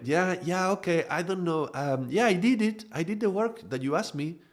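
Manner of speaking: put-on high, deferential child voice; high-pitched, submissive. Employee answering to the boss, high submissive voice. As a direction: pitch up high, giving power away. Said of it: "we do that also with our bosses".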